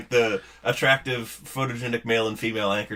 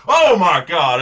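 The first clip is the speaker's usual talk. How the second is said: louder, higher horrified cry; mock horrified anchor; 5 semitones higher, 8 dB louder, slower than usual. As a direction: gasp it out in alarm. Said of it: "you just hear the".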